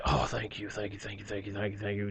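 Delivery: soft, low string of thank-yous; murmured retold thanks. Son retelling his thanks to the doctors, hushed and low. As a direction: run the thanks together under your breath.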